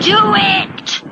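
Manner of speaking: high, loud barked order; yelled demand, pitched high; angry shout. A narrator's reading. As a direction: shout it as a furious command. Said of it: "he shouts".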